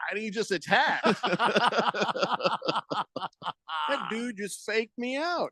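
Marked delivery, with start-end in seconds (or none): 1.1-3.8 real hearty laugh. Real, not acted. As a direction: burst out laughing. Laughing at dog's trick.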